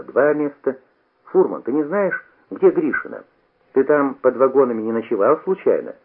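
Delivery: ordinary delivery, nothing marked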